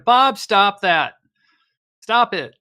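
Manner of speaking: loud, high scolding; yelling. Acted. Player as bar owner to regular hitting her TV, yelling. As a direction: holler slowly and firmly to make him quit. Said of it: "I'll yell at him".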